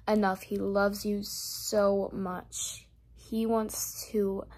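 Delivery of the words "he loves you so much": very slow, leaning on loves; drawn-out emphasis. Real, not acted. Speaker insisting to viewers on God's love. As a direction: give loves all the weight. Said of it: "I don't know how to emphasize this".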